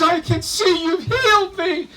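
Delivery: healed man thanking Jesus at his ankles; overjoyed. Acted. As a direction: burst with thanks at his feet.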